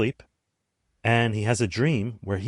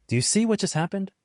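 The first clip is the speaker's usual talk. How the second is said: surprised; 10 semitones higher, faster than usual. Real, not acted. Reveals the idea wasn't original.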